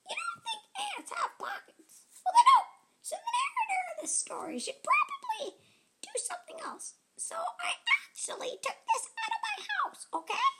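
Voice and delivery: very high, fast, laughing ant; giggly ant voice. Acted. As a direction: tease the listener as a giggling ant. Ant voice breaks character laughing.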